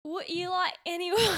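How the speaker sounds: amateur Cockney with dropped sounds; attempted Cockney accent. Shown as a demonstration. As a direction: try Cockney and crack up mid-word. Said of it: "talking about the Cockney accent".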